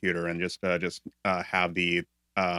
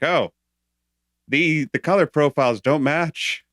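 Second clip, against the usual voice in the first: higher, louder complaining quote; exasperated quote; 8 semitones higher, 5 dB louder than usual. Retold or imitated. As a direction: whine that the colours don't match. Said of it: "it's like".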